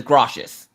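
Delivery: "gracias" said as "GRAH-shus"; "gracias" mangled into "groshus"; child's mispronounced gracias. Retold or imitated. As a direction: say "gracias" with a sh in the middle. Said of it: "She says".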